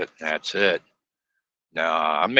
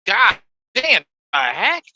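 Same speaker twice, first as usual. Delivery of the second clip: loud, high, disgusted exclamation; mad outburst; 11 semitones higher, 6 dB louder than usual. Retold or imitated. Trucker at the counter, tasting cold coffee: loud, high outburst. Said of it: "I was mad".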